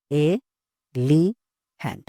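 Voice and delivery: "ए, ली, hand" as three spaced beats; syllable by syllable. Shown as a demonstration. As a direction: leave a clear pause after each of "ए, ली, hand". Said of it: "तीन बार हम claps किए".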